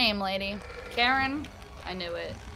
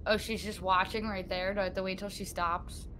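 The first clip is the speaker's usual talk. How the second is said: ordinary talk, nothing marked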